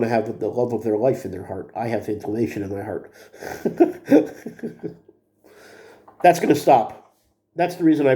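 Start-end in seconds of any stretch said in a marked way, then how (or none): 3.4-4.9 short breathy laugh. Real, not acted. Joke about heart inflammation.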